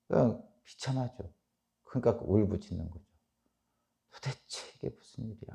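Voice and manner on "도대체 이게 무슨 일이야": hushed, much softer lament in Job's voice; retold anguished cry. Preacher voicing Job's disbelief, hushed imitated lament. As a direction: imitate a wail under the breath. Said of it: "하면서 울부짖는 거예요".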